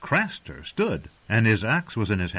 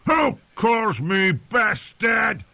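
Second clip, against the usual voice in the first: slow, high, furious roar; 12 semitones higher, much slower than usual. Acted. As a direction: bellow the challenge in rage. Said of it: "Craster roared".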